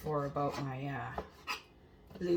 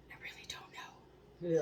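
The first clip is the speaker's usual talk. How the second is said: whisper; 5 dB quieter than usual. Real, not acted. Aside to self.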